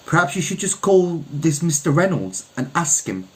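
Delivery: a hint of a young woman pressing him; Olivia's voice. A narrator's reading. Olivia nudging Kelso to call the landlord, lightly voiced.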